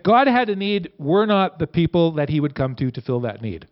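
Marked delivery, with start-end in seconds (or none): none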